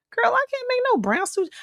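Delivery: quick, squeaky-high mock protest; high-pitched protest. Telling a friend she can't cook it, high mock protest. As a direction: replay your disbelief in a very high voice.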